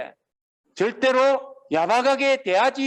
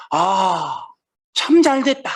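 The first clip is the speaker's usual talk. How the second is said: bright mock delight, in a voice unlike the preacher's; mock cheerful; 5 dB louder, much slower than usual. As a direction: play the son pretending to be glad. Imagined glad reply of elder son.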